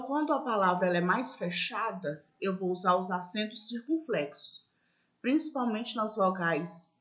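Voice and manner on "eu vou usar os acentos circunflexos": ordinary talk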